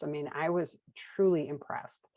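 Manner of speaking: ordinary delivery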